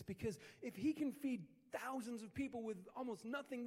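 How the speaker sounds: ordinary delivery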